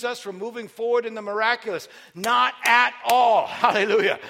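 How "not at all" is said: normal talking voice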